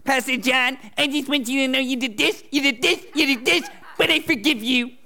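high, sanctimonious mock accusation. Pastor mocking a churchgoer who blames him while forgiving. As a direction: parody the smug forgiver pointing fingers. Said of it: "how most people interpret that".

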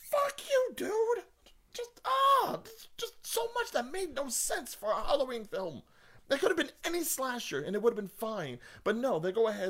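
very high, strained, whining rant; exasperated rant. Real, not acted. Fan ranting that the film made no sense, exasperated. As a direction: squeal in frustration, then ease slightly. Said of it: "Sorry, I'm ranting".

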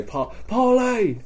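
high, slow stretched "parlay"; drawn-out parley call. Retold or imitated. Player shows how parley was called, high drawn-out hail. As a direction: hail with a long-held word.

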